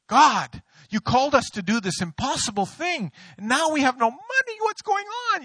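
high, rising, desperate plea to God; panicked prayer. Retold or imitated. Pastor in a faith meltdown crying to God, high panic.

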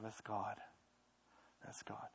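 very soft, almost gone; soft awed murmur; hushed awe. Real, not acted. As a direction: let it fade into awe. Awed hush repeated.

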